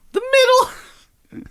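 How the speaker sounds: mock squeak. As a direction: squeak the word back mockingly.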